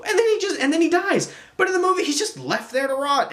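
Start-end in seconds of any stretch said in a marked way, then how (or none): none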